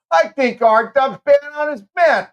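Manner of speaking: high, loud and slow tease; ribbing a co-host loudly; mock outraged. Real, not acted. Teasing co-host about time.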